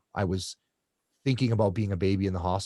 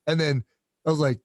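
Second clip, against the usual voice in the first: normal talking voice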